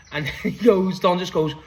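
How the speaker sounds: ordinary talk, nothing marked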